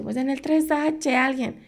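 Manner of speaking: high, slow call for help; answering the neighbours, called out high. Retold or imitated. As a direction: call out the flat number, high and drawn out. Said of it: "Y yo".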